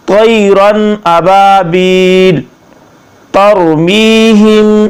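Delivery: slow tajweed with long held vowels; measured Arabic chant; flowing Quranic recitation. Shown as a demonstration. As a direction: recite the surah smoothly, stretching each verse ending. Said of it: "ज़रा रवानगी से पढ़ लेते हैं".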